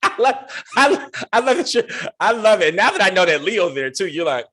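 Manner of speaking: a friend gushing at her friend, high excited squeals; gushing delight. Real, not acted. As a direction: gush over your friend with laughter breaking through. Gushing, laughing over friend.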